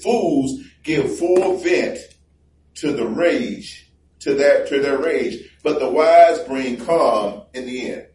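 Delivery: slow, weighty recitation of the verse; slow deliberate Bible reading; drawn-out scripture reading. Shown as a demonstration. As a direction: lean on each word like a preacher.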